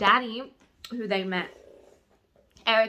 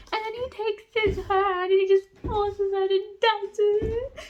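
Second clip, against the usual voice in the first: high-pitched fangirl squeal; giddy gushing; 10 semitones higher, 3 dB louder than usual. Real, not acted. Reader retelling the forced dance, giddy squealing.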